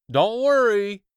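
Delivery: very loud, high call. Acted. Rescuer calling to the boy from the control panel. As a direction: shout it across the room. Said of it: "he called to me".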